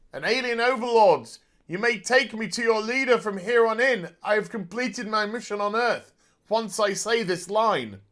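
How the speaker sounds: high, declaimed address; mock proclamation. Fan addressing alien overlords, mock proclamation.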